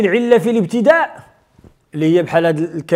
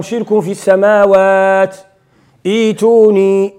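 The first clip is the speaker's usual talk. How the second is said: Quran recitation; 4 semitones lower, faster than usual. Acted. Teacher recites the verse from Surat al-Ahqaf for the class. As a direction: recite the verses evenly, fast.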